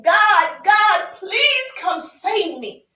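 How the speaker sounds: high, loud yell to God; screaming plea. Acted. Man on the roof screaming to God, desperate. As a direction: yell for rescue, stretching out the words. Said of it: "He's screaming and yelling".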